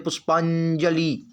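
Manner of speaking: पुष्पांजलि drawn out, ending in long "lee"; common misspelling sounded. Shown as a demonstration. Teacher showing how many write it, पुष्पांजलि stretched to long "lee". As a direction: stretch पुष्पांजलि slowly, holding the final vowel. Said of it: "बहुत लोग इसको ऐसे लिखते हैं".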